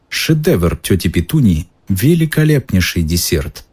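plain reading voice

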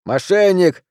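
high, heated cry; indignant outburst; angry accusation. Acted. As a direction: shout it over the reprimand. Accusation repeated over the reprimand.

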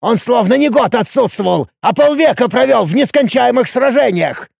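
high, heated insistence; горячий спор. A narrator's reading. Спор на крике продолжается. (горячо, на повышенных тонах)

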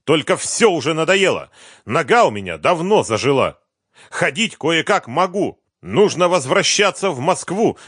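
high, loud exasperated shouting. Acted. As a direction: keep yelling at him. Boss keeps shouting his demands.

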